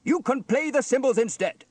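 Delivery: high, loud officer's order; commanding. A narrator's reading. Steele assigns Trevor the cymbals, commanding. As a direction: order it over the band.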